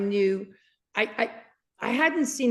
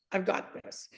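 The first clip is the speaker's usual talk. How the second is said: "I've got this" breathed out unvoiced and much softer; hushed; 3 semitones lower, 8 dB quieter than usual. Shown as a demonstration. Repeating the Lord's exact words to listeners, hushed. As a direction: let "I've got this" fall to a hushed whisper. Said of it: "Literally those words".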